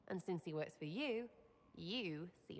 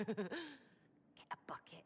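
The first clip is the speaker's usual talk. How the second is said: whisper; 3 semitones higher than usual. Acted. Sneaking a prank, whispering plans.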